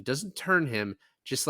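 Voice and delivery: usual speaking voice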